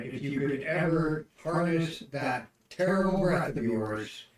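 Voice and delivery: loud, goading insult hurled at the wolf; jeering battle taunt, yelled out; taunting shout. Acted. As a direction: bellow it to provoke her. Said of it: "Trying to piss her off".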